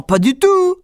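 high, loud flat denial; hammed-up giant retort; grumbling giant. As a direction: shut him down. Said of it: "grommela le premier géant".

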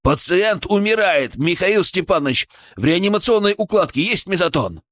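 high-pitched, desperate urgency. A narrator's reading. Medic pleads for drug as patient dies, shouting. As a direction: press the colleague urgently for help. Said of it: "Мой голос сорвался на крик".

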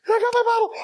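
high squeal of glee; ecstatic screaming. Acted. Shaking man to himself, ecstatic squeal. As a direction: squeal it, trembling with excitement. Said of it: "What is he screaming?".

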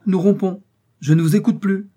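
high, loud and indignant; heated ultimatum. A narrator's reading. Detective threatens to split from his colleague, heatedly.

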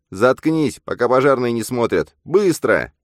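high, loud, urgent order; high-pitched impatient command; bossy double. A narrator's reading. Double silences Bogdan urgently.

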